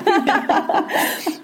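bright amused laugh; a quick laugh at herself; short laugh. Real, not acted. Laughs at own tangent. (kurzes Lachen)

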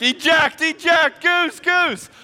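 high, loud bail-out call; mock panic. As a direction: shout it like a comic pilot ejecting. Mock pilot bailing out.

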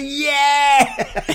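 high exultant shout; mock yell. Friend imitating Jordan's whoop, loud and high. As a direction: whoop it out like a party animal. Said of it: "he comes back and he goes".

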